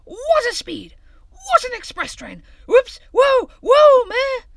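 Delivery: very high, loud breathless shouting; wild high shout down the slope; breathless thrilled cry. A narrator's reading. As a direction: yell with the rush of speed. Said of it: "cried Hare breathlessly".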